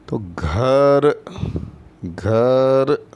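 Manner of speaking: घर stretched slowly, घ strongly aspirated; drawn-out model word. Shown as a demonstration. Teacher stretches घर out so children hear it. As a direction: hold घर long so kids hear the घ. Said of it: "उच्चारण कैसे आ रहा है वो देखिए".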